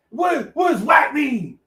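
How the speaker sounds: high, loud, slow mock bewilderment; affronted father. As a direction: imitate a dad outraged by slang. Father reacts to the insult.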